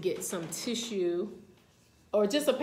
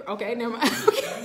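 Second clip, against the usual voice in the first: real, overcome giggle; laughing it off; 3 semitones higher, 6 dB louder, faster than usual. Real, not acted. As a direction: laugh away the urge to cry. Laughs off her welling tears.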